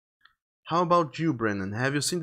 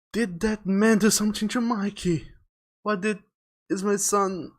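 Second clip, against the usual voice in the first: high, urgent mother's questioning; anxious demand; 8 semitones higher than usual. Acted. Mother to police about her missing son, anxious demand. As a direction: raise the pitch and press for answers.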